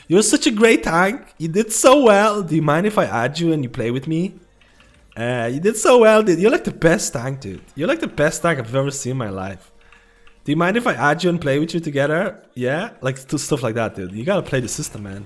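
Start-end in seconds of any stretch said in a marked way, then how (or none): 0.1-2.4 higher, louder gushing praise; over-the-top flattery. Retold or imitated. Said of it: "It's like". 2.4-4.3 flattering gamer. Retold or imitated. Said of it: "It's like". 5.2-6.2 higher, louder gushing praise; over-the-top flattery. Retold or imitated. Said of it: "It's like". 6.2-12.4 flattering gamer. Retold or imitated. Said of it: "It's like".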